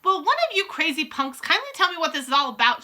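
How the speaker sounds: mildly shouted, indignant question; exasperated shout. A narrator's reading. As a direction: demand an explanation, fed up. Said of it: "shouted Bruno".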